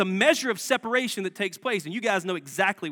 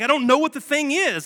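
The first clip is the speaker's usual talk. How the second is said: higher and louder, exasperated; snapping back; 7 semitones higher, 7 dB louder than usual. Retold or imitated. Husband snapping at his wife in the car, higher and louder. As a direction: snap back at her irritably.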